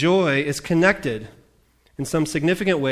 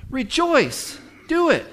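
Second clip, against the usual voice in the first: "Rejoice. Do it." much higher and loud; emphatic command; 11 semitones higher, 4 dB louder, slower than usual. Shown as a demonstration. Preacher demonstrates the emphasis, high and insistent. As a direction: hammer "Rejoice" home like an order.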